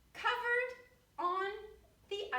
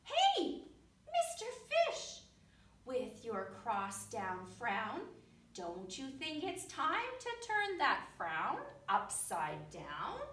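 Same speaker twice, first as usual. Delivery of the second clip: chirpy clam voice. Acted. Clam voiced, much higher.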